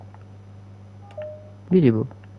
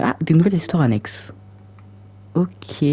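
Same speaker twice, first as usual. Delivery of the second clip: ordinary talk, nothing marked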